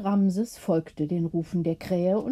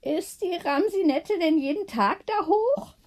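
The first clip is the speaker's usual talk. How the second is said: higher, lightly voiced curious old lady; Gertrude's question; 8 semitones higher than usual. A narrator's reading. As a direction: ask with a wondering rise. Gertrude asks about the cat.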